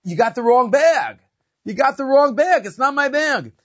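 high, loud retold shout from afar; screamed warning. Bag's owner screams out to the distant porter, loud high shout. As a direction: call out high and loud, drawing out the words. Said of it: "the owner screams out to him".

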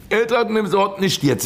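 high-pitched, insistent preaching; emphatic repeat. Shown as a demonstration. Preacher repeating what the rich man thinks about, emphatic.

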